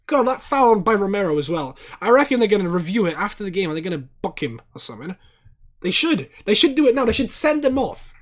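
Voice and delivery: loud, high outraged ranting at the screen; angry rant. Real, not acted. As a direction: shout it out at the dirty foul, furious. Angry fan rant at the foul.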